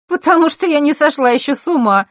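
loud, high scoffing answer; snort. Acted. Scene: Zakhara scoffing at her guest's question, loud and high. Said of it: "фыркнула девочка".

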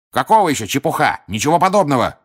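high, indignant outburst; affronted lady's high retort. A narrator's reading. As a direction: protest sharply, offended. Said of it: "возмутилась гостья".

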